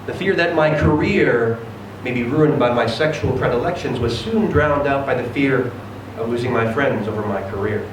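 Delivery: ordinary delivery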